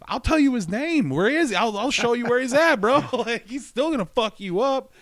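high loud eager tattletale; eager snitch. Acted. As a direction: rat him out with eager glee. Friend acting out snitching.

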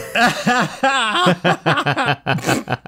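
high, acted cackle; drawn-out mock laughter; bartender's laugh. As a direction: laugh long as the onlooking bartender. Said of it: "the bartender's going".

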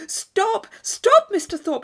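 high, loud, urgent pleading; impatient cry. Acted. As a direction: call out to halt the carriage. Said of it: "she impatiently cried".

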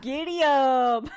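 slow, drawn-out cowboy twang; put-on country drawl; Southern twang. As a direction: stretch it out like a mock cowboy. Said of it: "keep on the southern twang".

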